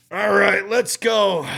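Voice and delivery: put-on high, loud drunken readiness; calling back. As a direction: call it out gamely to the cop. Drunk answers the cop's call.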